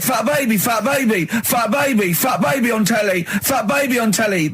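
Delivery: high mock parrot of Carl. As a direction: repeat it high and dopey, mocking him. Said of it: "You just said".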